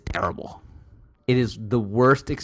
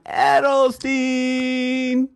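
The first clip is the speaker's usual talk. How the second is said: announcer shout; 13 semitones higher, 5 dB louder, much slower than usual. Acted. Announcer intro of co-host.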